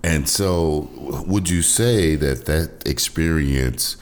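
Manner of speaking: normal talking voice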